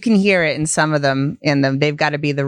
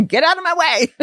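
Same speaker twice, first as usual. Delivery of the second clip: raised, laughing brush-off; brusque mock impatience; 12 semitones higher than usual. New Englander explaining her people's bluntness, mock impatient. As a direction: playfully order someone aside, pitch up.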